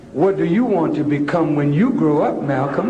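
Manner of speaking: low-pitched teacher putting a question to a pupil; eighth grade teacher. Retold or imitated. Preacher quoting Malcolm's teacher to the congregation, low-voiced teacher. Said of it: "asked by his eighth grade teacher".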